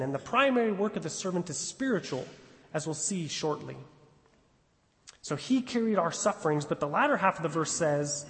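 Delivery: ordinary talk, nothing marked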